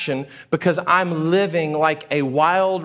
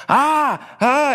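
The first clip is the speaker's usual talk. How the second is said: sharp high yelps of eye pain; 8 semitones higher than usual. Retold or imitated. Person with a speck in the eye yelping in pain. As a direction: yelp like something's in your eye. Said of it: "You're like".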